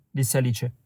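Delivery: plain reading voice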